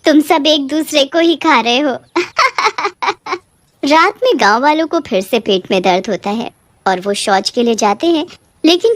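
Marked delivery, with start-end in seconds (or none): 1.9-3.4 high mocking cackle; witch cackle. Witch laughs at villagers.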